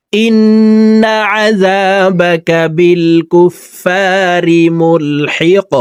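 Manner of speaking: ملحق ending on a bouncing stopped qaf; qaf with qalqala. Shown as a demonstration. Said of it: "দেখুন, এইভাবে উচ্চারণটি করব".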